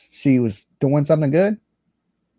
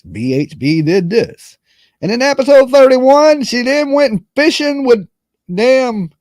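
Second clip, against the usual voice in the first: high, mocking recital of trivial claims; mocking narrator voice; 8 semitones higher, 4 dB louder than usual. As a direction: parody the list as a pompous announcer. Mocking the attorney's document listing.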